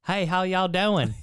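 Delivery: loud, high, drawn-out Southern greeting; mock Southern accent. Imitating Indiana locals greeting visitors, mock Southern accent. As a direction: imitate a big slow Southern hello. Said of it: "talked with a deep Southern accent".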